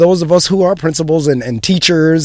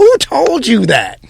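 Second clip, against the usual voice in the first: slow, higher, pointed counselor question; guidance counselor asking; 6 semitones higher, 3 dB louder, slower than usual. Retold or imitated. Guidance counselor asks the boy who told him that, slow and higher. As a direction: ask it slowly like a probing counselor. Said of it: "looked at him and said".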